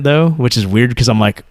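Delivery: ordinary talk, nothing marked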